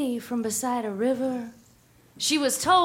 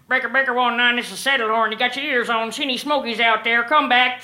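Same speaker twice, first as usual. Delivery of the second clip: CB radio trucker; 4 dB louder, faster than usual. Acted. CB radio call between truckers.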